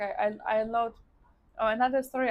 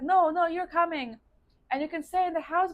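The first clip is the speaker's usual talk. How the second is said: higher, louder mock insistence; insistent friend; 6 semitones higher, 5 dB louder than usual. Friend urging her to come to Perth, insistent. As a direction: press the invitation, imitating a friend.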